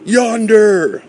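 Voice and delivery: high drawn-out holler; high-pitched sighting cry; shout. Acted. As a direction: call it out as if pointing far off. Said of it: "shouted a man".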